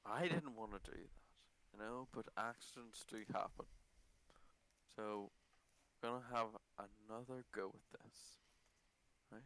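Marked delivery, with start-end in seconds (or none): none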